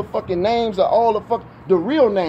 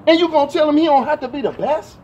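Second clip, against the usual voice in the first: raised-pitch, indignant questioning; mad confrontation; 7 semitones higher than usual. Acted. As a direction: scold an absent co-parent in disbelief. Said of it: "I'm saying it like that 'cause I'm mad".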